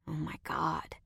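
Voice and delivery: breathy unvoiced whisper; relieved whisper. A narrator's reading. Roy whispers in relief as the strangers leave. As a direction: exhale it in relief.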